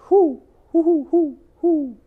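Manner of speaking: high, loud hooting whos; owl hooting. Acted. The great horned owl hooting at the skunk, high and loud.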